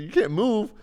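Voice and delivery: shocked she cannot move; incredulous. Retold or imitated. To frozen girlfriend, high incredulous outburst.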